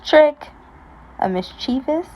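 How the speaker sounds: plain reading voice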